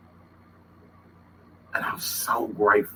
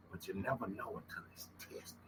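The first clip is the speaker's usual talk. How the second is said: whisper; 17 dB quieter than usual. Real, not acted. Emotional closing reflection.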